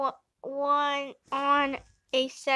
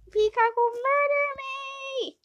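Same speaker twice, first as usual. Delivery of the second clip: panicked high voice; 12 semitones higher, 3 dB louder than usual. Acted. Panicked plea to be let in.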